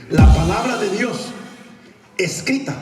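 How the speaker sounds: loud, high, slow proclamation; forceful emphasis. Real, not acted. Preacher repeats his thesis to congregation, loud emphasis. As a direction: hammer it out to the crowd.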